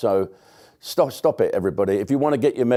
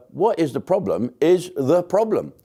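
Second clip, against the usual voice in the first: ordinary talk, nothing marked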